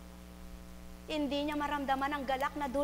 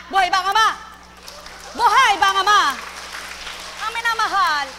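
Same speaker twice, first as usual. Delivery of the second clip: loud, high preacher's call to the faithful; preaching at full force; 6 semitones higher, 12 dB louder, faster than usual. Real, not acted. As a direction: proclaim it to the whole congregation. Call and response chant. (malakas na pangangaral)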